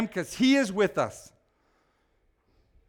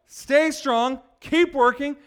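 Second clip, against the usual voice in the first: normal talking voice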